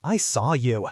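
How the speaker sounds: ordinary delivery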